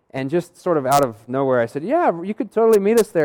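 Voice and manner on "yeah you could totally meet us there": high, loud retold enthusiasm; eager invitation. Inviting the caller to New Orleans, overeager. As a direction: blurt it out with over-keen cheer.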